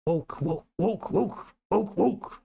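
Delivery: rising mock woofs; dog bark imitation. Shown as a demonstration. Amused at the dog word, playful barking. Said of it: "there's literally woke".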